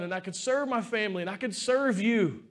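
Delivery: normal talking voice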